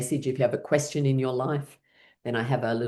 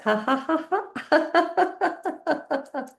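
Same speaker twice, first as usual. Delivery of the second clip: high laugh; 14 semitones higher than usual. Real, not acted. Tapping with laughing.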